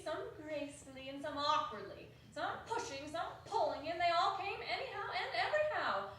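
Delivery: ordinary delivery, nothing marked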